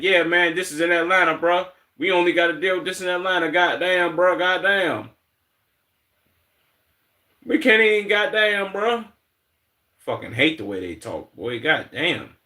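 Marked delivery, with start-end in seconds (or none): 0.0-9.0 higher mock Atlanta voice; mock Atlanta accent. Streamer mimicking Atlanta locals, in a higher mock Atlanta accent. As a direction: parody locals insisting it's only Atlanta. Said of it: "why are they so adamant on saying".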